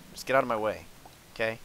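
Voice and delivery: ordinary talk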